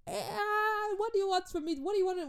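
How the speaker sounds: high-pitched comic despair; mock exasperated wail. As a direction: wail it like a hammed-up victim. Acted exasperation at the child's questions.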